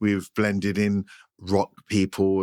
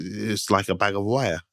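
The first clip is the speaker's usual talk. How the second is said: normal talking voice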